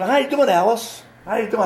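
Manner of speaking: high-pitched teasing hello; mock greeting. Classmates ribbing a boy about his roll-call name, teasing.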